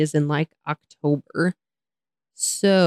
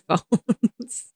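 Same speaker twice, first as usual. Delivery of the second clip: ordinary talk, nothing marked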